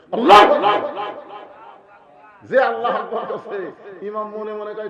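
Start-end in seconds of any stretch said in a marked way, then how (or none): 0.1-1.1 loud, higher imam's call; called-out takbir. Acted. Imam mimicked calling the congregation to rise, called out loud. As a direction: call it out to lead the prayer.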